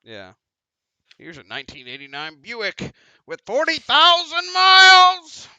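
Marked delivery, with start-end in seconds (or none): 1.2-5.5 shouted car-lot sales pitch, high and drawn out; booming mock salesman, slow and high; used-car salesman pitch. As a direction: holler it like a hammy TV car dealer.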